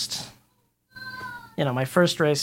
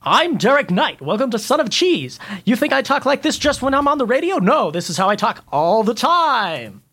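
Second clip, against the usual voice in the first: impression of Derek Knight; 6 semitones higher than usual. Mocking the show's host with a pumped-up radio voice. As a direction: play up the host's showy radio voice. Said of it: "your impression of me".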